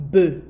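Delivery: B as a short "buh", not "bee"; E sound demonstrated. Shown as a demonstration. Teacher showing learners how the E is pronounced, B said as "buh". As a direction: sound out B as a loud, isolated "buh". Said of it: "this E is pronounced as".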